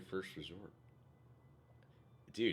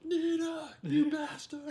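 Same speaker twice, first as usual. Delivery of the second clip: high, loud anime yell; anime shout; 7 dB louder, much slower than usual. Pretend. Acting anime-style lines at film.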